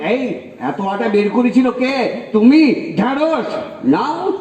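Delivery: imitated scolding, high and rising; angry wife. Wife turns on husband over wasted dough, angry scolding. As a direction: act out a wife venting on her husband. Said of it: "গায়ে ঝাল ছাড়ে".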